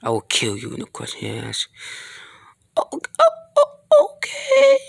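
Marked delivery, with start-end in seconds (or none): 2.8-4.8 very high, breathy frightened gasps; frightened squeak. Acted. High-voiced character gasping in fright, then giving in. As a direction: squeak out a scared okay.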